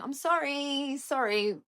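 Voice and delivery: high, drawn-out embarrassed sorry; mock apologizing. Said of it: "all I could do was apologize".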